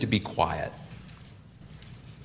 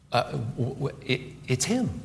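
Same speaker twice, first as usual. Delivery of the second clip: softer, halting stammer; stunned realization; 7 dB quieter than usual. Acted. Blind man realizes who is passing, stunned. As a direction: stumble into the realization.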